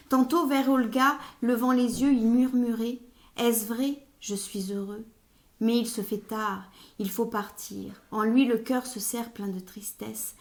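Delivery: projected, measured story narration; literary reading aloud. Acted. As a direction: read the novel extract out with emphatic phrase openings. Reads a novel extract aloud.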